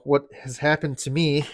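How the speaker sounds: normal talking voice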